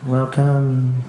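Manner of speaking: low, ominous machine voice; sinister welcome. Acted. The big machine greeting outcasts, sinister. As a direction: intone it low like a menacing host.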